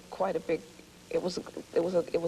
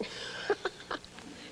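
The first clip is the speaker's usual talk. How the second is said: breathy laugh; 9 dB quieter than usual. Real, not acted. Laughs about growling stomach.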